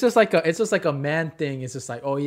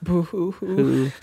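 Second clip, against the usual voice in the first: fake crying; much slower than usual. Mock sad boy crying.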